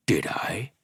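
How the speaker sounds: breathy threatening whisper; menacing whisper. A narrator's reading. Trapper mocking Barbara's accusation, menacing whisper.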